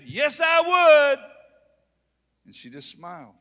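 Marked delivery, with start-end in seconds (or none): 0.0-1.2 loud slow shout, much higher; shouted slow affirmation; emphatic yes. Retold or imitated. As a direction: declare it proudly. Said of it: "I said".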